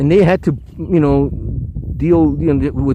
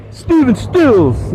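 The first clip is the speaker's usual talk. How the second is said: calling out; 8 semitones higher, much slower than usual. Real, not acted. Calling out to passing celebrity.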